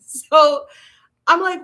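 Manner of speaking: usual speaking voice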